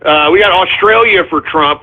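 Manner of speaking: ordinary talk, nothing marked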